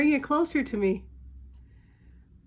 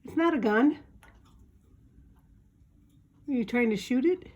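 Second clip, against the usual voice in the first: ordinary talk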